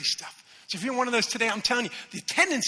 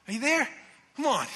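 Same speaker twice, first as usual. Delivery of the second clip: ordinary talk, nothing marked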